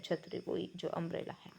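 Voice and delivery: quick whispered aside; a quick whispered explanation. Real, not acted. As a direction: drop to a whisper and rush it.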